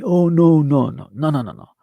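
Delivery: loud mock protest, falling away; mocking deniers. Imitating anybody telling him no, mocking protest. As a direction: run the no's together, starting loud and dropping. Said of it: "if anybody telling me".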